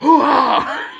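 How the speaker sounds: laughing, loud Pacino shout; Al Pacino hoo-ha. Retold or imitated. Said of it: "I can't do him at all".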